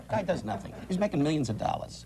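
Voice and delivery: ordinary talk, nothing marked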